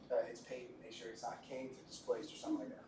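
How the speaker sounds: ordinary talk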